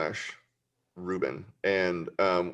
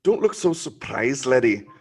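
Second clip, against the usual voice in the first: slow, higher-pitched Scottish brogue; hammed-up Scottish voice, projected; Scottish accent; 4 semitones higher, 6 dB louder than usual. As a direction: put on a broad comic Scottish accent, stretched out. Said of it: "in your, uh, Scottish, uh, accent".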